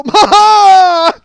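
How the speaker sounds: high, very loud cackle; reenacted big laugh. Comic reenacting his laugh from the back, loud cackle. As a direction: burst out laughing as you did then.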